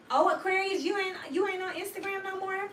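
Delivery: loud high mock gossip voice; nosy friend. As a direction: play the prying acquaintance, loud and high. Mock nosy friend questioning Aquarius.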